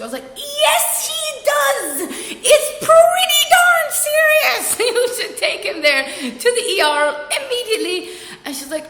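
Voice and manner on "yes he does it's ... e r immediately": high, loud, drawn-out insistence; exasperated yelling. Retold or imitated. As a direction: shriek each point in mock outrage.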